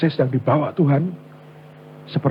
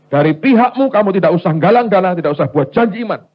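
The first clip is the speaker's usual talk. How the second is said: God's firm instruction; 4 semitones higher, 7 dB louder than usual. Retold or imitated. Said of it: "Tuhan ngomong ke saya begini".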